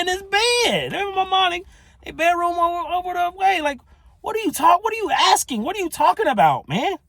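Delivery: high, loud, exasperated retelling; frustrated friend. Retold or imitated. Fraternity brother exasperated by endless questions about his dad, high and frustrated. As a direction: voice the baffled friend losing patience. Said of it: "he getting frustrated with me".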